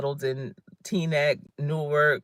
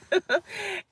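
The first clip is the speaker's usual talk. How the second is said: high bright burst of laughter. Real, not acted. To her viewers after her female joke, a high laugh. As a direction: laugh at your own joke.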